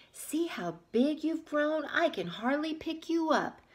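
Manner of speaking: a hint of a proud bear; Bear's voice. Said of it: "Bear said to Little Bird".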